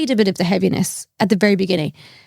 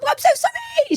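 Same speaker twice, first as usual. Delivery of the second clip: flustered apology. Retold or imitated. Beth apologising, arriving late.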